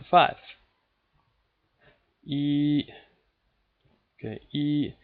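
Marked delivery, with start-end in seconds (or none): 2.2-2.8 E held high and level; first tone. Shown as a demonstration. Teacher shows learners the first tone, steady high E. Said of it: "that would be the first tone". 4.5-4.9 E held high and level; first tone. Shown as a demonstration. Teacher shows learners the first tone, steady high E. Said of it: "that would be the first tone".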